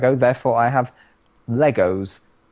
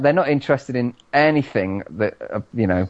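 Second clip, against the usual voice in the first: usual speaking voice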